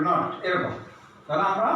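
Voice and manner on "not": not with a straight short o; straight usual vowel. Shown as a demonstration. Teacher sums up the rule, straight short vowel in not. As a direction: say not with the vowel left open. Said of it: "সোজা উচ্চারণ হবে".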